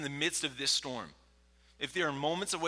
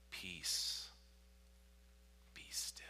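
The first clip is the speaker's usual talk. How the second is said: low, soft whisper with long pauses; whispered command; 6 semitones lower, 11 dB quieter, much slower than usual. Acted. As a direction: still the storm with a whisper. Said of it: "He whispers".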